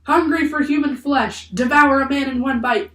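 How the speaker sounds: high, loud menacing cries; crowd shouting. A narrator's reading. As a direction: shout like a worked-up mob. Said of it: "everyone kept shouting".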